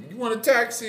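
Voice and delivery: high, hammed-up Italian taxi tout; mock Italian accent offering a taxi. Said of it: "I don't know why he sounds Italian".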